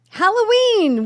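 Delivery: high, loud burst of delight; thrilled outburst; excited exclamation. Real, not acted. Seizing on the topic.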